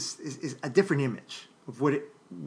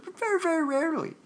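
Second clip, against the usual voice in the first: much higher, quick squeaky aside; joking squeak; faster than usual. Acted. Lecturer teasing students about inventing words, in a squeaky voice. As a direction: pop the joke up into a tiny high voice.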